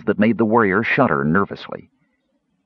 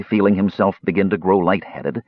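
plain reading voice

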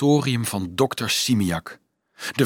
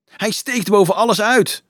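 raised, high-pitched crowd shout; calling out; 9 semitones higher, 5 dB louder than usual. A narrator's reading. Friend pointing out tall Rudolf to others, calling out. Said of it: "klonk een bekende stem".

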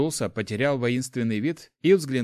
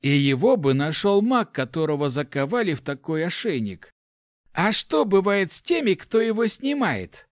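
raised, biting sarcastic retort; caustic; 6 semitones higher, 4 dB louder than usual. A narrator's reading. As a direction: throw it back with venomous irony. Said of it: "язвительно ответил Ларс". (язвительно, с сарказмом)

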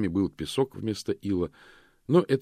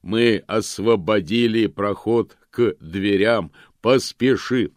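booming golem; 2 semitones higher, 7 dB louder, slower than usual. A narrator's reading. Golem urges hero to hurry.

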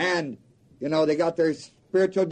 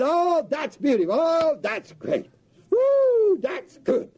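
high, gushing cheer with a whoop; mock excited crowd; 10 semitones higher, slower than usual. Crowd cheering the odds, high and gushing. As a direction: swoon over the odds, then whoop.

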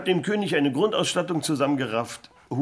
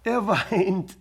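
dramatic reveal; 7 semitones higher, 4 dB louder than usual. Acted.

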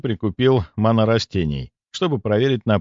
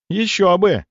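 indignant; 10 semitones higher, 4 dB louder, slower than usual. A narrator's reading. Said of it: "возмущённо проговорил Сеня".

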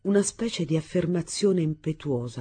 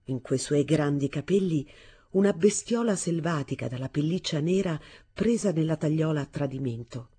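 plain reading voice

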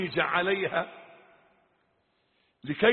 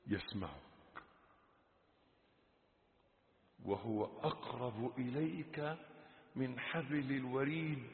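hushed admonition; 9 dB quieter than usual. Real, not acted. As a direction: reproach the listener quietly, with weighty pauses. Quiet reproach, slowly rising. (عتاب وعظي خافت يتصاعد)

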